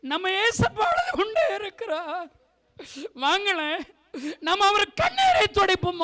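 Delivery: words breaking into tears; real tears in the sermon; tearful plea. Real, not acted. Preacher pleads, Jesus is crying.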